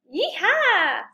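high, drawn-out cowboy whoop; storybook cheer; whooping call. A narrator's reading. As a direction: let out a gleeful holler. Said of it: "called Henry".

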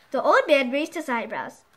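usual speaking voice

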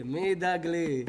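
slow, drawn-out question; slow rhetorical question; slow musing. Shown as a demonstration. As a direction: let the question hang slowly. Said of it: "repeated question".

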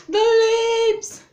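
high, loud mock-dramatic cry; dramatic announcement. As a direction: shriek it like a big reveal. Dramatic reveal of tough part.

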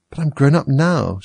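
mildly sorrowful, drawn-out words. A narrator's reading. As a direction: say it slowly, with a touch of sorrow. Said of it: "she added in a sorrowful tone".

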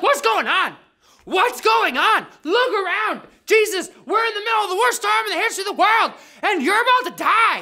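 loud, high exasperated yelling; frantic angry shout. Acted. Disciples yell at Jesus mid-storm, frantic. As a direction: shout back his question in disbelief.

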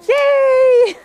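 high, loud, held cheer; excited cheer. Real, not acted. Cheering the dog on in the snow, excited.

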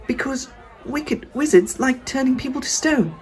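put-on high young princess voice; bright defiant princess. A narrator's reading. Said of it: "replied Princess Eliza".

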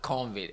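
"covid" with an intrusive n, as CON-vid; rejected pronunciation. Shown as a demonstration. Host ruling out the wrong name, saying CON-vid. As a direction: say "covid" as the mistaken "convid". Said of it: "Not covid".

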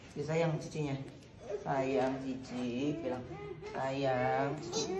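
soft and very slow, gently coaxing. Real, not acted.

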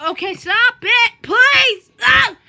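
loud, high begging into a yell; hammed-up frantic pleading; desperate plea. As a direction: beg them to stop, yelling. Begs attacker to stop.

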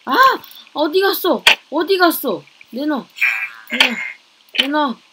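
loud frantic demands; frantic yelling. Real, not acted. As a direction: yell it, panicked and loud. Item suddenly vanished, yelling. (잃어버린 물건에 다급하게 소리치기)

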